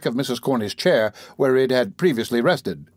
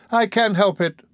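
high, helpless little complaint; whimpering; 7 semitones higher than usual. A narrator's reading. Distressed lady answering the beadle, whimpering. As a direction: plead helplessness in a raised pitch. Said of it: "whimpered the lady".

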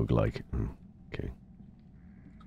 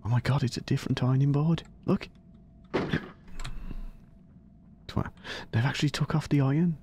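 Oh my god up high, amazed; surprised; 14 semitones higher than usual. Real, not acted. Streamer spotting the ironing board has changed, surprised. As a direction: jump up in pitch at the discovery.

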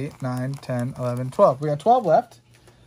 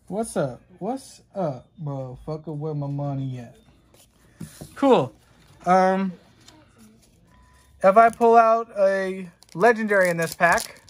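ordinary talk, nothing marked

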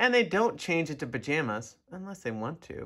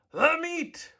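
much higher shouted echo; crew roar; 13 semitones higher than usual. A narrator's reading. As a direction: roar it back like hungry pirates. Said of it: "we all roared".

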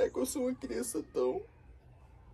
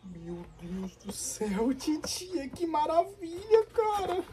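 overjoyed; 9 semitones higher, 3 dB louder than usual. Acted. Boy overjoyed by aunt's gift.

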